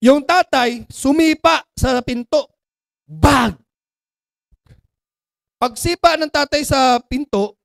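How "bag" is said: loud imitated bang; bang sound effect. Father kicks the door, loud imitated bang. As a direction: make the door slam noise loud.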